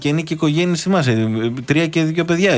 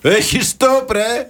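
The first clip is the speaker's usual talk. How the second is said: hammed-up driver's shout; mock shout; 10 semitones higher, much slower than usual. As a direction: yell it like an angry driver at a crossing. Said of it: "Φωνάζει ένας τον άλλο".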